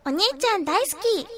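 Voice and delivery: put-on high, sweet anime girl voice; high cute anime girl. Shown as a demonstration. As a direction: coo it like a cute anime heroine. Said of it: "アニメ声って可愛いじゃないですか".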